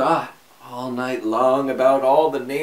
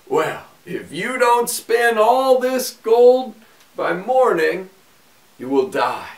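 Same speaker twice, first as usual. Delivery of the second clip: threatening king; 6 semitones higher, 4 dB louder than usual. A narrator's reading. King threatens the miller's daughter.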